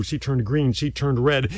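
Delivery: usual speaking voice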